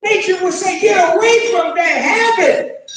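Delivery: loud, high, driving sermon voice; fiery preaching. Acted. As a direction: preach it hard to the crowd. Preaching at full force.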